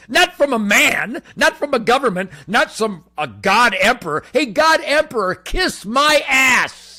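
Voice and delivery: loud defiant yell; defiant shout. Real, not acted. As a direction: bellow it at the emperor.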